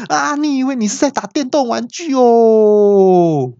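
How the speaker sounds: high, drawn-out exasperated cry; angry yell. Retold or imitated. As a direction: yell in mock outrage, stretching the end. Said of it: "我就气到大叫说".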